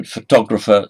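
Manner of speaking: photographer with a loud TOG, the second syllable; pho-TOG-rapher, second syllable strong; stress on second syllable. Shown as a demonstration. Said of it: "stress is on the second syllable".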